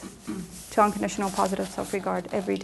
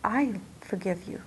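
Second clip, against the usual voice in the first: much softer, near-whispered comfort; tender forgiveness; 5 dB quieter than usual. Retold or imitated. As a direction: soothe yourself like a kind friend would. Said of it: "I couldn't say".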